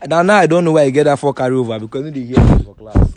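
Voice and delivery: loud accusing outburst. Real, not acted. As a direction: call him out loudly. Accusing friend in argument.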